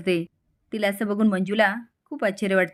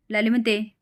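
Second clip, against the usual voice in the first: plain reading voice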